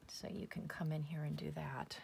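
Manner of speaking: whispered, almost to herself; whispered aside. Real, not acted. Crafter talking herself through the stickles, whispered. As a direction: drop to a whisper while concentrating. Quiet aside while working the glue.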